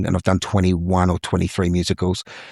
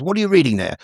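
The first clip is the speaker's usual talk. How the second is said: put-on higher-pitched older British gentleman; British accent; 8 semitones higher, faster than usual. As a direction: greet politely in a British accent. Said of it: "in British accent".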